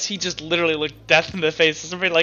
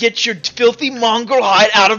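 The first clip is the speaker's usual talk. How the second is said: raised, indignant tirade; angry shopkeeper; 2 semitones higher, 4 dB louder than usual. Acted. Shopkeeper orders a customer out of his store, angry. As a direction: order them out, punching the insults.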